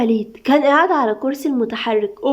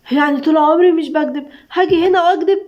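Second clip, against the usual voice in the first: higher, louder angry outburst; 5 semitones higher, 4 dB louder than usual. A narrator's reading. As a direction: snap back furiously while struggling not to drown. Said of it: "هاجر بعصبية وهي بتحاول ما تغرقش".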